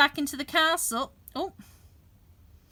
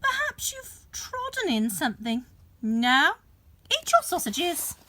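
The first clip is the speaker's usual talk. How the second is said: giant's wife; 6 semitones higher than usual. A narrator's reading. Wife voiced in story.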